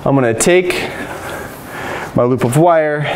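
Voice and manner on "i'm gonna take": ordinary delivery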